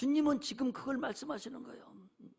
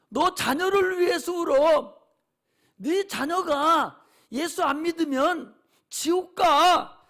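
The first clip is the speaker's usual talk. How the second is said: louder and slow, pressing each word; urgent warning; 7 dB louder, slower than usual. Acted. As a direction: hammer home the warning. Jesus's warning, preached hard.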